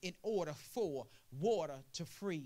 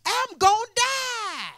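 high, slow, loud wail of dread; a cancer patient's drawn-out cry of fear; terrified patient; 12 dB louder, much slower than usual. Acted.

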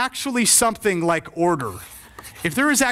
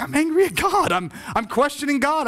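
high-pitched, mildly acted outburst at God; retold anger; 6 semitones higher than usual. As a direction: imitate an angry pastor confessing his doubt. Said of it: "I'm just angry".

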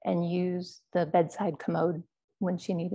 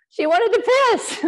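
much higher and much louder, still fired up; excited; 10 dB louder than usual. Real, not acted. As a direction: blurt it out, still caught up in it. Still laughing over the bellow.

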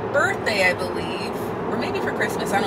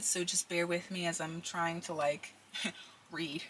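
talking around a retainer; slightly lisped retainer speech; wearing a retainer; 2 semitones lower, 14 dB quieter than usual. Real, not acted. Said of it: "I've also got my retainers in".

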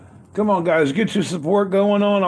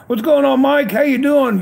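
higher and louder, pumped-up hello; hyped greeting; 8 semitones higher, 4 dB louder than usual. Real, not acted. Welcoming Mike to the stream, loud and high-spirited. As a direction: call out to him like a buddy walking in.

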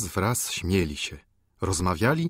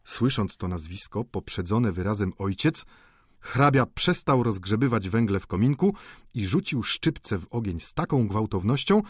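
ordinary delivery

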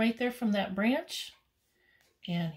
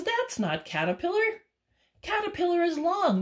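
high, insistent raccoon; a little raccoon setting things straight, voice up high; 10 semitones higher, 4 dB louder than usual. Acted. Raccoon telling hummingbird it is not Caterpillar, high.

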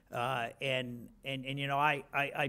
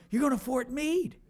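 higher, altered voice delivering the news; exec's announcement; 10 semitones higher, 4 dB louder than usual. Retold or imitated. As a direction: announce it in someone else's voice. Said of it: "And he goes".

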